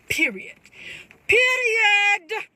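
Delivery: second period shouted high and loud; drawn-out emphatic period to end the argument. Real, not acted. Emphatic repeated declaration.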